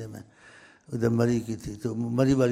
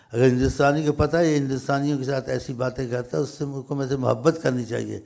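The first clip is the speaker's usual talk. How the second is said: usual speaking voice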